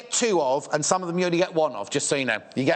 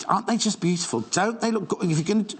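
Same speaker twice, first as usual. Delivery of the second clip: usual speaking voice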